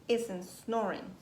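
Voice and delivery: plain reading voice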